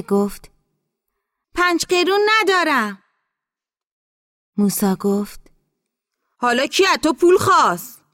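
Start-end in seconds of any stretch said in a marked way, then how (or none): 1.5-3.0 high, raised voice carrying across distance; high-pitched holler; calling back. Acted. Calling back to tractor driver. 6.4-8.0 high, loud retort; raised, high-pitched challenge; shouting back. Acted. Driver shouting back from tractor.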